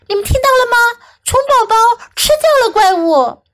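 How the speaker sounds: high-pitched storybook shout; shouting inchworm. A narrator's reading. Inchworm tells everyone the news, shouting. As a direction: spread the news in a high, excited call. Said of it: "他大喊起来".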